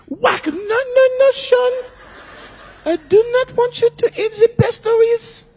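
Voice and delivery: shrill, very high mock voice of a tiny wife scolding her husband; scolding French wife. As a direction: squeak out the scolding like a bossy little wife stopping him from drinking. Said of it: "and she goes".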